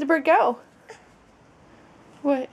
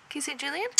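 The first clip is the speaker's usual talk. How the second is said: much softer, rising question; hushed prompt; 3 semitones higher, 12 dB quieter, much faster than usual. Real, not acted. Parent prompting toddler to say a name, hushed. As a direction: coax softly, under your voice.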